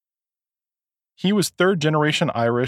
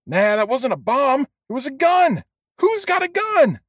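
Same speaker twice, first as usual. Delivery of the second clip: panicked; 13 semitones higher than usual. A narrator's reading. Panicked customer in crowd.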